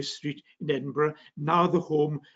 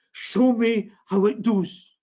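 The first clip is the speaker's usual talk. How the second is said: put-on Scottish accent; 5 semitones higher, 4 dB louder, slower than usual. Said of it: "my Scottish accent is from the f-, northeast".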